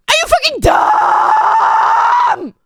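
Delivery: very high, slow, loud scream; screamed insult. Real, not acted. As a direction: scream it, stretching each word. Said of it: "peak outburst".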